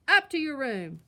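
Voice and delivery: very loud furious roar. A narrator's reading. Papa sending cubs upstairs, furious roar. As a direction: bellow the order. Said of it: "roared Papa".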